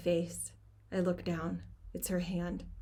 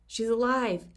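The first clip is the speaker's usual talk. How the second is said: loud relieved call; 4 semitones higher, 7 dB louder than usual. A narrator's reading. Rescuer reports over radio.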